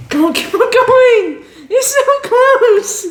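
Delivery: laughing high-pitched cheer; excited cheering. Real, not acted. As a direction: cheer her on, giggling.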